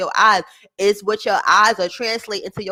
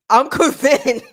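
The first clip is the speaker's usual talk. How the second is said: laughing; 2 semitones higher, 5 dB louder, slower than usual. Real, not acted. Reacting to clip, laughing.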